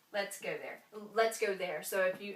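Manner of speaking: ordinary talk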